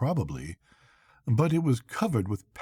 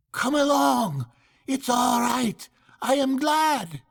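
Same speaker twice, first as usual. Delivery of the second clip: much higher, cheery shouted reassurance; cheerful yell; 13 semitones higher than usual. A narrator's reading. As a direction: call back brightly, not worried at all. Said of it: "as cheerful as you please".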